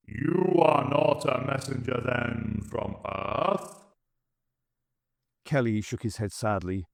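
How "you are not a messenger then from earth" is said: slow, cold alien verdict; Dalek Emperor. Acted. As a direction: judge him like an unfeeling machine ruler. Emperor questions Kelly.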